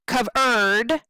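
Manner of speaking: COVERED with ERD drawn long; short "cov", long "ERD" in covered; wrongly stressed second syllable. Shown as a demonstration. Teacher showing wrong stress, COVERED with a long ERD. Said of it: "We don't say".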